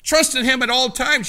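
loud, high preaching cry; full-force pulpit shout. Real, not acted. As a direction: call it out to the whole church. Preacher exhorting the congregation.

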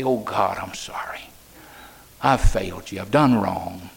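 low, hushed confession, a whisper on I'm sorry; whispered repentance. Retold or imitated. Preacher voicing a backslider's plea to God, hushed whisper. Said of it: "someone to say".

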